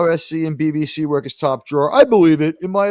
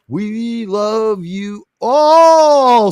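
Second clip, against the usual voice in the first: drawn-out, loud, higher farewell; big sign-off; 5 semitones higher, 7 dB louder, much slower than usual. Real, not acted. Host closing the show to viewers, big drawn-out farewell. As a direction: stretch the last word into a showy send-off.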